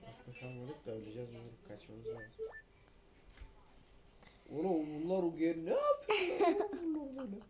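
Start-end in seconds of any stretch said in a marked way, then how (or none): 4.5-7.4 loud, high, laughing; laughing yell. Real, not acted. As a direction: shout through laughter. Laughing while yelling.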